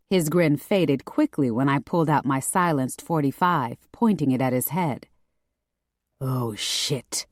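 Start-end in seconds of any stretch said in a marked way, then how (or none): 6.2-7.3 low, soft murmur of dismay; hushed, deep male character murmur. A narrator's reading. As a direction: murmur it low, under the breath. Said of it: "he murmured in that sexy Greek accent".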